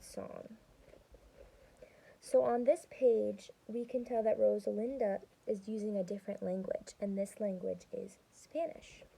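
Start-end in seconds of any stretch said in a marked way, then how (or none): none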